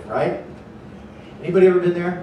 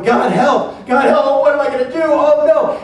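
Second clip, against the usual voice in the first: high, loud mock cries for help; hammed-up panicked pleading; prayer of panic; 9 semitones higher, 7 dB louder than usual. Panicking people crying out to God, mock frantic cries. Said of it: "It's a prayer of panic".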